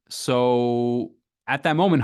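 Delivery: usual speaking voice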